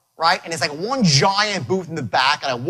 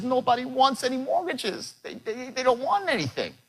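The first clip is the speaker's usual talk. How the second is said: lightly voiced tearful woman, higher and softer; a mild hint of Shelley near tears, higher; Shelley crying; 6 semitones higher, 5 dB quieter than usual. Retelling his partner's wife on the bench, a mild tearful higher voice. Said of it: "I see her sitting on a bench crying".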